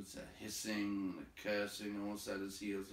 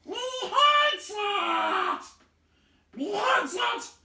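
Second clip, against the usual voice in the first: far higher, screaming creature fury; shrieking Gollum voice; 17 dB louder, much slower than usual. A narrator's reading. Gollum screaming after the fleeing thief, shrieking hatred. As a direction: shriek it like a hateful cave creature. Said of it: "but menacing, the voice came behind".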